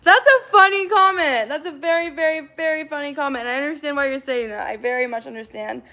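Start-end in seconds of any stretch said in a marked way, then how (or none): 0.0-3.4 drip sarcasm over the comment; sarcastic. Real, not acted.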